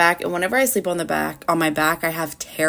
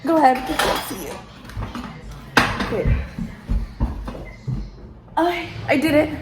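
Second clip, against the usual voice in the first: echoey room. Real, not acted. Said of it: "it's really echoey in here".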